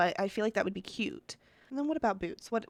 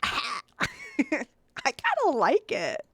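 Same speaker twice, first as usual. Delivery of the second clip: very high, drawn-out slow squeal; giggly delight; 13 semitones higher, 4 dB louder, much slower than usual. Real, not acted. To viewers on the finished doll, high giggly delight. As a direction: squeal it slowly through a giggle.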